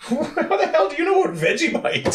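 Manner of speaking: very high, disbelieving squeal; astonished tourist. Retold or imitated. Aussie tourist amazed the vendor knows Vegemite, very high squeal. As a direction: gasp in mock disbelief. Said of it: "And they say".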